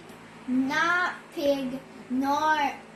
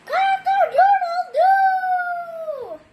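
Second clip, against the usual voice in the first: very high, loud, slow crowing shout; rooster crow; 7 dB louder, slower than usual. Acted. Rooster waking everyone at sunrise, loud crow. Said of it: "Rooster woke up with a".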